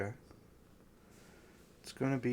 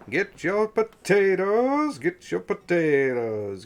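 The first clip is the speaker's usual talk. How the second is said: loud, drawn-out vendor cries; sing-song market hollering; 4 semitones higher, 10 dB louder, slower than usual. Acted. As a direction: holler wares across the street. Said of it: "you can hear vendors yelling across".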